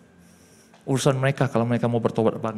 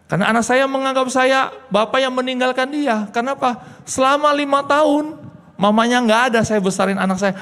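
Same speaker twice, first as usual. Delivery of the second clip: high, loud pleading sermon; impassioned preaching; 10 semitones higher, 7 dB louder than usual. Acted. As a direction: pour it out much higher and louder. Impassioned preaching about his child. (khotbah penuh emosi, keras dan tinggi)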